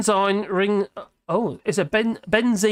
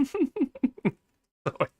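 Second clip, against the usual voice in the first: ordinary talk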